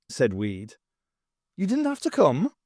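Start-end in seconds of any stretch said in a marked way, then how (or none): 1.6-2.5 pointed lady voice. Acted. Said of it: "pointed out Maisie Grace".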